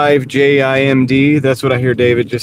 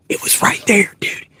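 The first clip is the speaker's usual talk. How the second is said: higher hushed excited whisper; 9 semitones higher than usual. Pretend. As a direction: whisper the thrill. Still hushed after the drop.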